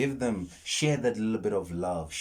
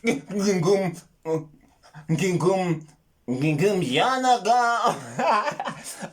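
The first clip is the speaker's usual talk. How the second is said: higher, louder, drawn-out fake Wakandan chant; mock movie accent; 10 semitones higher, 8 dB louder, much slower than usual. Mocking the film's accents, drawn out and laughing. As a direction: mangle it like the film accent. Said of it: "how it sounded when they did those accents".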